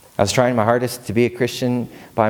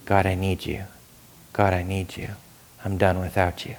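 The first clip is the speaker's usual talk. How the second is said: softer, lower, intimate prayer voice; tender prayer; 4 semitones lower, 6 dB quieter than usual. Pretend. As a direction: pray it quietly like a plea.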